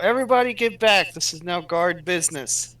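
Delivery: loud commanding yell. Acted. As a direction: bark the order at the room. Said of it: "she's gonna yell out".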